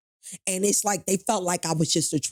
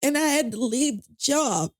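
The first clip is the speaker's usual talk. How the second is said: holding back crying while telling it; near tears; 8 semitones higher than usual. Real, not acted. Telling the host about the lost interview, close to crying. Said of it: "forgive me for getting so emotional".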